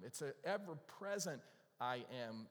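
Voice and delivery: ordinary delivery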